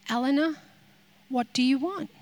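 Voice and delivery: soft, hushed question; God speaking softly. Retold or imitated. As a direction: ask it gently, barely above a breath. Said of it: "he has turned to me and said".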